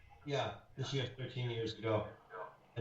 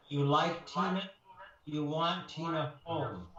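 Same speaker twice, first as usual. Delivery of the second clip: slow careful question; slower than usual. Retold or imitated. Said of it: "she says".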